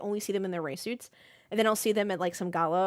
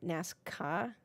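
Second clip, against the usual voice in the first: "nascar" with the R dropped, "nasca"; New England accent, say "nascar" without the R; 2 semitones lower, 6 dB quieter than usual. Shown as a demonstration. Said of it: "I can't say the R".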